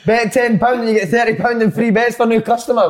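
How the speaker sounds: punchy upbeat ad read; hyped betting ad. Acted. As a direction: sell it like a bookie ad. Reading sponsor promo aloud.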